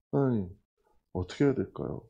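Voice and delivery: low, soft appeal to God; retold prayer, voice dropped low; quiet helpless prayer. Worried director asks God for help, quiet helpless prayer.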